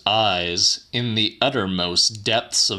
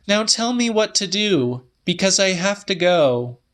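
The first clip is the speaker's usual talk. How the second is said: voiced much higher as the woman; Celia's voice; 11 semitones higher than usual. A narrator's reading. Woman telling the old man her news, higher voice. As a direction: lift the pitch well up for her lines.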